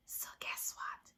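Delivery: geheimnisvoll leise gehaucht; hushed question. A narrator's reading. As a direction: let the question drop to a near-whisper. Draws listeners into the story.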